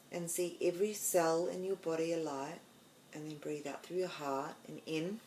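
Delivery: soft, hushed meditation voice with long pauses; guided meditation. Acted.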